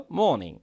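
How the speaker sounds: 'মর্নিং' as 'moh-ning', no r; British pronunciation. Shown as a demonstration. Teacher explaining the silent r, British 'মর্নিং'. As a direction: round the mouth on 'মর্নিং', r silent. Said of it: "আর এর উচ্চারণটা হতো না".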